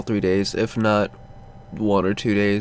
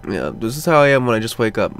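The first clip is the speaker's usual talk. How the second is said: normal talking voice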